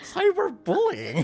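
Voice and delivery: incredulous. Real, not acted. Incredulous echo.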